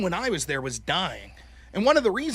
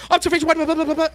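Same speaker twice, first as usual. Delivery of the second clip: mock auctioneer; 7 semitones higher, 8 dB louder, much faster than usual. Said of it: "Not talking like an auctioneer".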